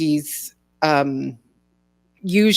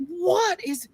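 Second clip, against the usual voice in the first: baffled; 9 semitones higher, 6 dB quieter, slower than usual. Retold or imitated. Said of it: "it's like".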